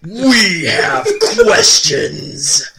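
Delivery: slow, loud barking sergeant shout; drill sergeant shout. Acted. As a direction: scream orders at the recruits. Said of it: "Shouts Drill Sergeant Nasty".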